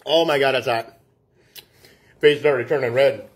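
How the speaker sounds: usual speaking voice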